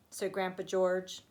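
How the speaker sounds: ordinary delivery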